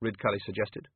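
plain reading voice

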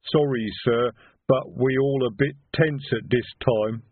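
slow, lumbering troll voice; apologetic troll. Acted. Troll explaining to sir why all are tense, slow and sheepish.

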